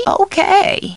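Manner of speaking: high-pitched, lilting okay; bright agreement. Acted. Housekeeper agreeing on the phone, high lilt. Alternate takes of stock line.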